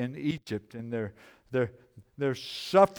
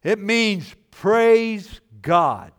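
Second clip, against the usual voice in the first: It means praise God, slow, praise hit loud; slow emphasis; 5 semitones higher, 6 dB louder, much slower than usual. Shown as a demonstration. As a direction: stretch out praise God so it lands.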